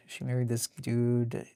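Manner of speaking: ordinary talk, nothing marked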